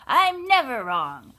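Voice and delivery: loud, slow insistence; indignant shout. A narrator's reading. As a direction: insist she is never wrong. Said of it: "Eugenia shouted".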